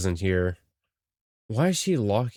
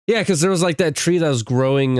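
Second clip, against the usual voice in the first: higher and louder, bursting with the thought; excited idea; 6 semitones higher, 5 dB louder than usual. Real, not acted. Player realizing they might be trees, excited. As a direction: blurt out the realization.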